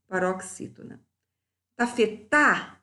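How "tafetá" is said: "Tafetá" slow, with a higher, firm "tá"; final syllable stressed. Shown as a demonstration. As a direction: lift and lean on the last syllable of "Tafetá". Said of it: "Sílaba tônica é a última".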